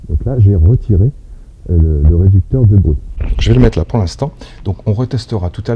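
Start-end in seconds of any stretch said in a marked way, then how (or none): 0.1-4.3 mic inside headphones. Real, not acted. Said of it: "le micro est dans le casque".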